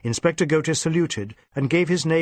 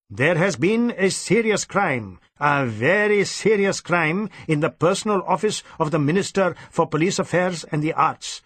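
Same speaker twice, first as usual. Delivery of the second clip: pompous superintendent; 5 semitones higher than usual. Acted. Superintendent briefing his inspector, pompous and stern. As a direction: lay down the orders with self-importance.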